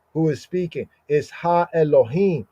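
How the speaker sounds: usual speaking voice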